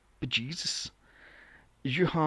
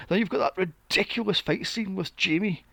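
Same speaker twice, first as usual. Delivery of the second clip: ordinary delivery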